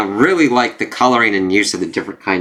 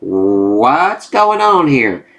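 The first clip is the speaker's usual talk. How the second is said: slow bewildered drawl; 3 semitones higher, 3 dB louder, much slower than usual. Retold or imitated. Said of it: "It's like".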